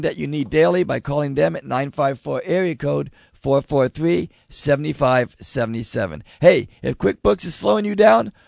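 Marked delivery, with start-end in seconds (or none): none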